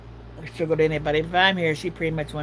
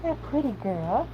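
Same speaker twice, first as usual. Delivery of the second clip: dog's voice; 8 semitones higher, 3 dB quieter, slower than usual. Pretend.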